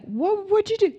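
imitating her younger shocked self. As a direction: gasp it out in disbelief. Her own shocked reply.

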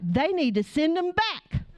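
high, slow mock outrage; imitating fuming daughter. Daughter demands studio recall her pictures, mock fuming. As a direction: demand it indignantly, drawing out each word. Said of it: "fuming mad".

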